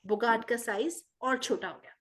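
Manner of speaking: plain reading voice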